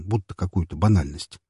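plain reading voice

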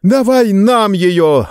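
put-on a drunken gang cheering; gleeful roar. A narrator's reading. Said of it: "радостно заорала вся компания". (радостный рёв компании, выше и громче)

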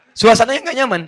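loud, high, forceful declaration; emphatic. Real, not acted. Preacher stressing the home feels wrong without Quran, loud. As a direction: hammer the point home loudly.

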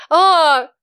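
sharp high yelp; a sudden cry of shock; startled cry. Acted. Героиня шагает в жаркую парилку. (испуганный вскрик)

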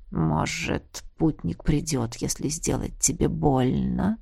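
soft low menacing whisper. A narrator's reading. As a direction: breathe it into her ear. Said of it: "прошептал некромант".

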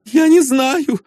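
put-on high, startled girl's answer; high startled denial; frightened young lady. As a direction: blurt it out like a scared young lady. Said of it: "проговорила Эльза".

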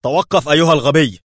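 loud, high, commanding shout; stern shout. A narrator's reading. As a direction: shout a strict order to halt. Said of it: "صاح به ليفي في صرامة".

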